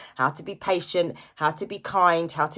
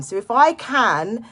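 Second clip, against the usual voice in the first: ordinary talk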